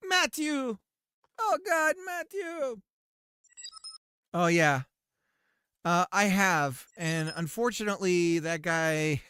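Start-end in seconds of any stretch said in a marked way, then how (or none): 0.1-2.8 high, calling someone's name. Real, not acted. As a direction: call Matthew from across.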